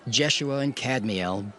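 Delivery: ordinary talk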